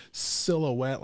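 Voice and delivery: ordinary talk, nothing marked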